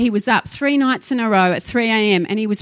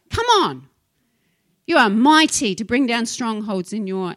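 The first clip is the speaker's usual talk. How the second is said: ordinary delivery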